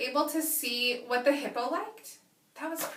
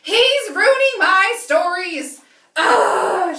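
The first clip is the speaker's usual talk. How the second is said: loud, higher, slow grumble ending in ugh; frustrated; 6 semitones higher, 14 dB louder, much slower than usual. Acted. Lila fed up with the hippo, loud slow ugh. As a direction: stew over it. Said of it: "she's super having a hard time".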